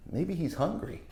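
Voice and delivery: ordinary delivery, nothing marked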